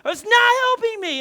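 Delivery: high, loud frantic yell; panicked driver yelling at sister; yelling back. Retold or imitated. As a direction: shout back in panic while steering. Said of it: "I was yelling back".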